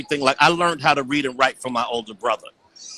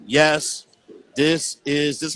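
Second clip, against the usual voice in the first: slow, halting word-by-word robot read; robotic reading; much slower than usual. Retold or imitated. Little boy reading aloud to his big brother, slow robotic voice. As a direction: sound out each word like a kid reading mechanically. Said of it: "this is why I'm talking like a robot".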